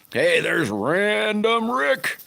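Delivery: high, drawn-out call of hello; sing-song greeting. Real, not acted. Greeting Rick joining the live.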